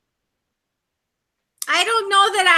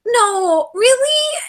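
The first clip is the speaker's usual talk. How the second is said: high, tearful cry of disbelief; a wail of shocked "really?"; 7 semitones higher, much slower than usual. Retold or imitated. Retelling her reaction to Chris's claim, tearfully shocked. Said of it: "but I'm like".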